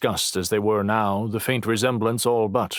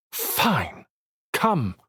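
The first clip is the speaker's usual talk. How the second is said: bitten-off; 7 semitones higher than usual. Acted. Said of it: "Dossett bit out".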